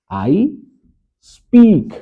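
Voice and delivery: I speak, with speak high and loud; stress on speak. Shown as a demonstration. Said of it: "बोलने के लिए हमने speak का प्रयोग करना है". (speak पर ज़ोर, ऊँची तेज़ आवाज़ में)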